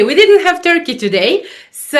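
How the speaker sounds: usual speaking voice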